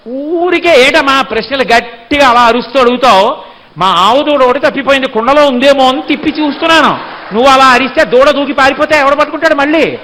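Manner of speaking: put-on loud, indignant bluster of a caught child; blustering retort. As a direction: turn the accusation back on her, loudly. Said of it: "ఇంత దబాయింపా అని". (దబాయిస్తూ గట్టిగా)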